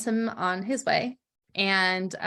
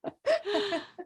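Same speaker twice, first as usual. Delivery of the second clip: high laugh; 11 semitones higher, 4 dB quieter than usual. Real, not acted. Laughing at her own story.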